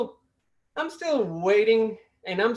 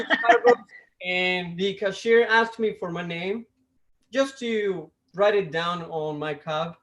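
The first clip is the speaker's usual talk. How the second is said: normal talking voice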